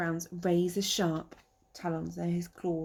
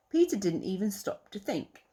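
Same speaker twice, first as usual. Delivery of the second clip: ordinary delivery, nothing marked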